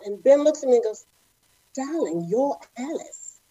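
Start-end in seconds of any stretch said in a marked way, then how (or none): 1.7-3.4 a light hint of a British accent, soft and slow; British director. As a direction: play the posh ballet master gently, lightly British. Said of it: "he's British, right?".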